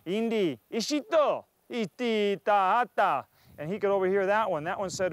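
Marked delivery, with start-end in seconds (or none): 0.1-3.3 slow made-up captor words; lightly voiced captor speech; mock Indian language. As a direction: chant the strange talk out slowly. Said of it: "another Indian said".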